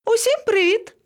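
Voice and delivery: very high sing-out greeting; cheery calling out. A narrator's reading. Monkey calling down from the tree, cheery. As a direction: hail everyone in a high voice.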